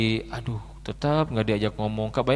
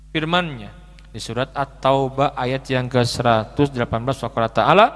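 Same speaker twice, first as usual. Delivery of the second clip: ordinary delivery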